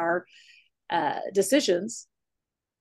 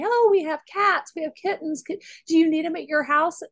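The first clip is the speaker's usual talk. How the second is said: much higher, sing-song offering voice; pushy friend voice; 10 semitones higher, 4 dB louder than usual. Retold or imitated. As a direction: gush like an eager friend offloading kittens. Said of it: "we would have friends that would say".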